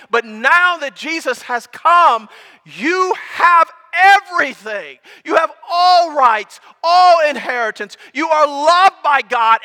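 high, loud, slow proclamation; preaching at full force. Real, not acted. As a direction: build to a triumphant shout. Climax of sermon, building to shout.

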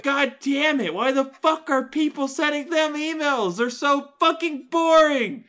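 high, loud furious rant; angry yelling. Real, not acted. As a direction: shout out the grievance. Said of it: "I know I'm yelling and I'm angry".

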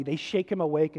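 ordinary talk

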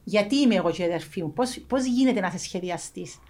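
everyday Cypriot Greek speech; cypriot greek accent. Real, not acted. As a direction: speak with a natural Cypriot accent. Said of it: "Cypriot speech throughout". (κυπριακή προφορά)

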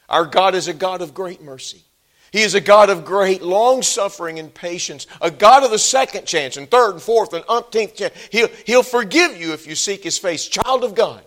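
preaching. Acted. Preacher proclaiming God's mercy at full force. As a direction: lift the room with mercy.

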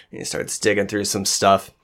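ordinary delivery

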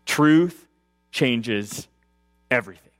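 Truth, changes, everything each set apart by pauses; slow, spaced phrase. Shown as a demonstration. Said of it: "Keep that phrase in your head".

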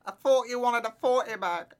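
the dealer offering a bag, squeaky loud mock voice. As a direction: squeal it like a cheeky dodgy dealer. Acting the dealer, loud and high.

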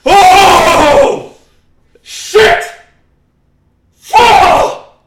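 high frantic screams of relief; panicked screaming. Retold or imitated. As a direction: burst out of the dark screaming. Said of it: "I just, like, run out".

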